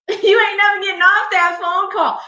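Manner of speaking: high, loud, laughing exclamation; amused outburst. Real, not acted. Exclaims at a viewer's comment.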